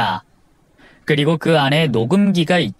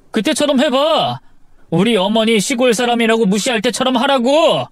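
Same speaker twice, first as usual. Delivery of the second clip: high-pitched, heated taunting; bitterly sarcastic outburst; angry challenge; 8 semitones higher than usual. Acted. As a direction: dare your wife to insult you to your face. Husband taunts his pleading wife.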